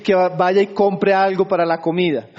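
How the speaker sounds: usual speaking voice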